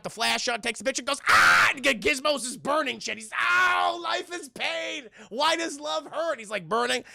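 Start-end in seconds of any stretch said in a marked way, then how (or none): 1.3-1.7 loud, abrupt acted scream; sudden full-volume yelp; pained scream. Creature hit by camera flash. 3.4-6.4 high, drawn-out creature wail; hammed-up suffering, stretched out; mock agony. Gizmo burning in agony.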